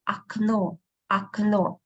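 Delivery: "окно" said ak-NO, first O as A; unstressed O as A. Shown as a demonstration. Teacher showing why first O changes, "окно" as ak-NO. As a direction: open "окно" on ah, stress the final O. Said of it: "the first one we pronounce as A".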